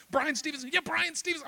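high, eager acted back-and-forth; excited recognition. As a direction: trade the name in rising excitement. Excited father-son exchange acted out.

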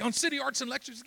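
high-pitched, excited check; eager question. Retold or imitated. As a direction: ask it as an amazed recognition. Son's eager question acted out.

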